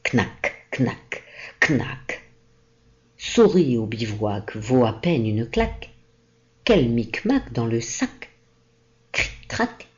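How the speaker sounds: a wooden soldier rallying, ringing; ringing nutcracker call. Acted. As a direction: Nutcracker crying out, lightly voiced, clacking consonants. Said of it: "s'écria d'une voix retentissante".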